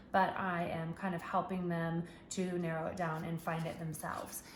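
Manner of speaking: ordinary delivery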